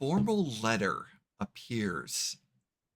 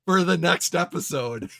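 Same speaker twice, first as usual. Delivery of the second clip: laughing, raised-voice cut-off; loud cliffhanger announcement; 9 semitones higher, 11 dB louder than usual. Real, not acted. Game master halts players to end the episode, loudly amused. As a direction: talk over the table, high and loud.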